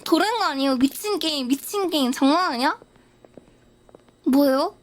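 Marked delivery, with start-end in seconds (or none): none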